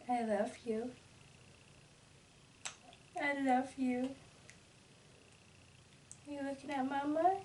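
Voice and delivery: very soft, tender cooing. Real, not acted. Mom softly cooing to baby.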